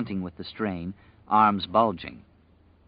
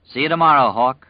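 called out; 4 semitones higher, 10 dB louder than usual. Acted. Wyzak calls out in parting.

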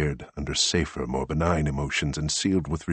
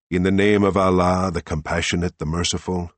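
louder, reverent quotation; solemn recitation; 4 semitones higher, 7 dB louder than usual. A narrator's reading. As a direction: intone the holy words. Greg quotes the Quran.

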